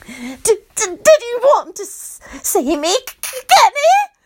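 stammering with eager longing; passionate stutter. Acted. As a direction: stutter it out breathlessly. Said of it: "she stutters passionately".

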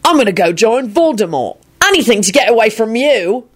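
high, fed-up outburst; exasperated yell. A narrator's reading. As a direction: threaten to storm off. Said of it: "he yelled".

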